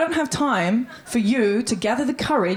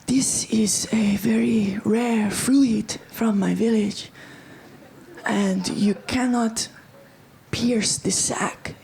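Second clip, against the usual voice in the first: hushed mystic; slower than usual. Retold or imitated. Mock mystic man with rare fruit.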